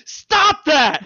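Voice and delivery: high, loud mock yell; telling Egon off for the calculator, loud. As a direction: yell it to stop him. Venkman snaps at Egon.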